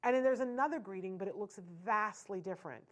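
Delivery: ordinary talk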